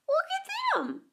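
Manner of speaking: put-on very high amazed child; high excited little boy; cried out. A narrator's reading. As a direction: gasp it out in wonder. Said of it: "Michael cried".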